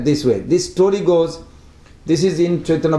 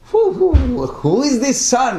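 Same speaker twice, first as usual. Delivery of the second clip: astonished; 8 semitones higher than usual. Retold or imitated. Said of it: "Advaita Acharya was like".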